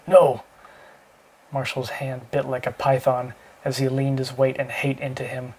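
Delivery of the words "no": sudden loud cry of no; a choked, loud protest; sputtered. A narrator's reading. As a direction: blurt it out, choking and desperate. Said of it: "Howard sputtered".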